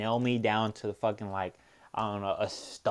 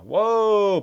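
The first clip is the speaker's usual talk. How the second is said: sudden loud high whoa; startled, almost yelling; surprised whoa; 12 semitones higher, 11 dB louder than usual. Real, not acted. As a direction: caught off guard by the screen. Surprised at a sudden screen graphic.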